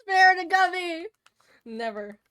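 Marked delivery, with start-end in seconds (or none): 0.0-1.1 loud, slow, high dramatic plea; mock plea. Streamer begging chat to spare the gubby, loud slow plea. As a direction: beg loudly for mercy, drawn out.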